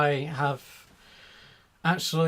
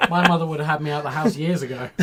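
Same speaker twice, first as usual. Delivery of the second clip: normal talking voice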